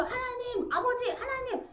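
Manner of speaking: high, urgent calling of God; sudden cry to God. Shown as a demonstration. Believer in crisis calling on God, urgent cry. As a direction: cry out on the spot.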